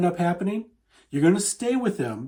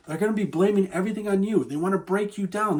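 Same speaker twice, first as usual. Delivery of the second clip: ordinary talk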